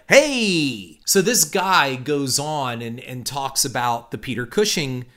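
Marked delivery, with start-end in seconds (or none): none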